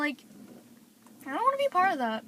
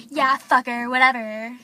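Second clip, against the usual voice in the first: dismissive; 7 dB louder than usual. Retold or imitated. Desiree brushing off apology.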